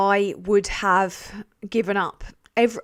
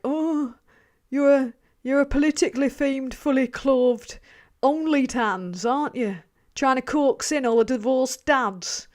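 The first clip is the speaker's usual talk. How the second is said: Darren; 3 semitones higher than usual. Acted. Streamer mocking herself as a tease, in a put-on voice. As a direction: put on a teasing bloke's voice. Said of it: "Sorry for doing my Darren voice".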